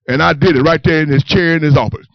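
ordinary delivery

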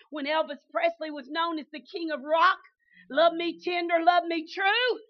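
loud, slowed preaching punch; emphatic preaching. Acted. Preacher asks the congregation what matters, loud and emphatic. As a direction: drive the point home.

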